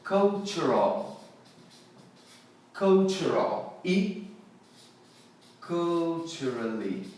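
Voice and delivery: cultural and culturally drawn out slowly, starting KUL; model pronunciation. Shown as a demonstration. Teacher giving derived words, slow model of cultural and culturally. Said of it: "daí se originam as palavras".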